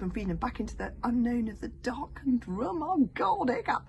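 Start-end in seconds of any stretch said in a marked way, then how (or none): 2.8-3.8 high loud frightened yelp; alarmed cry. Acted. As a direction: cry out in sudden fear.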